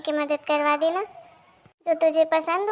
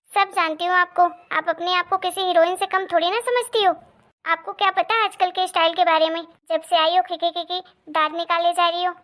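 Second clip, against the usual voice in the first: ordinary talk, nothing marked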